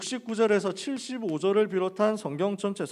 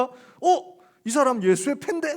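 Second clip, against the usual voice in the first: high, louder exclamation of recognition; startled accusation; 8 semitones higher, 5 dB louder, slower than usual. Retold or imitated. Bystander spotting Peter by the fire, startled accusation. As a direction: call out a suspect on spotting him.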